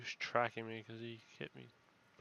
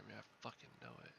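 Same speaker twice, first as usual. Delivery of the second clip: fast tense whisper under breath; scared whisper; 2 semitones lower, 9 dB quieter, faster than usual. Real, not acted. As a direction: hiss it fast, bracing for a scare. Dreading a jump scare.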